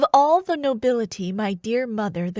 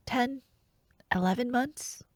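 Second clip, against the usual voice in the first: usual speaking voice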